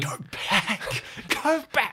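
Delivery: urgent warning. Acted.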